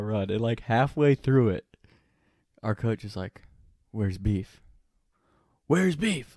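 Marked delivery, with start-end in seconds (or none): 5.7-6.4 mock scream. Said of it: "Like, screaming".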